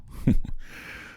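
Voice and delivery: short, breathy chuckle; amused chuckle. Acted. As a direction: let out a brief laugh at the book title. Reacts to the host's book title.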